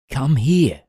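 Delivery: lightly voiced cat calling out. A narrator's reading. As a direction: call the friend over, lightly in character. Cat calls boy over.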